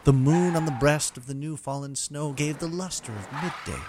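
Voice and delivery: rhythmic storybook poem recital; lilting irish storyteller. Acted. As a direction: read it like a Christmas poem. Night Before Christmas parody narration.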